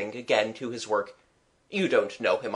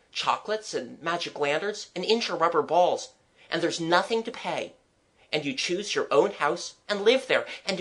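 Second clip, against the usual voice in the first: put-on higher, lilting, eager child; 6 semitones higher than usual. Child describing a dream city to the artist, eager. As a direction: run on keenly through the list. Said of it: "I went on eagerly".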